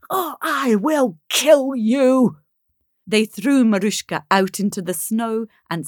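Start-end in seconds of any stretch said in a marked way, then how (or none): 0.1-2.4 slow, high-pitched menacing threat; screamed threat. Acted. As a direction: draw out the threat furiously. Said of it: "screamed stepmother".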